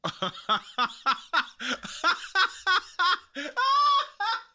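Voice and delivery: high, loud, rolling laughing fit; hysterical laughter. Real, not acted. Streamer seeing the picture, high rolling laughter. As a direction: laugh in waves you can't stop.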